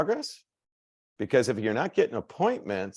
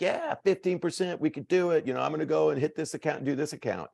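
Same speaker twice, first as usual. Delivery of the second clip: higher, hyped-up mock cheer, fast at the end; mock fired-up team; 7 semitones higher than usual. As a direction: imitate the team getting pumped, half-joking. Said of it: "like, fired up and be like".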